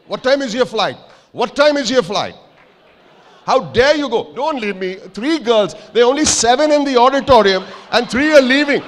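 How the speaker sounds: high-pitched, indignant holler across the room; loud, high protest at deserters; calling out in protest. Real, not acted. Calls after people leaving the auditorium.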